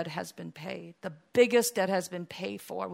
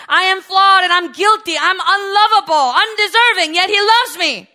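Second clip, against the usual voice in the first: high, shouted, impassioned climax; full-force preaching; 9 semitones higher, 17 dB louder than usual. Real, not acted. Preacher declaring the Lord loves the undeserving, shouting fervently. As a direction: cry it out at the top of the voice.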